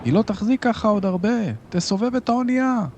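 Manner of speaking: very high sailor's cry, little added volume; screamed warning. A narrator's reading. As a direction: cry out urgently at the top of your range. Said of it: "צרח אחר". (צריחת אזהרה גבוהה)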